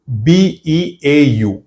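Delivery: slow letter-by-letter chunk; spelled chunk slowly. Shown as a demonstration. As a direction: dictate "ई एन" as one slow chunk. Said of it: "ये एक word हो गया". (धीरे-धीरे टुकड़ा बोलें)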